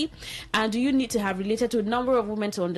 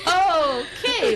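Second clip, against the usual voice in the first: okay said through a real laugh, higher and louder; 9 semitones higher, 3 dB louder than usual. Real, not acted. Host still laughing at the caller's remark, laughing okay. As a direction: still laughing as he tries to move on.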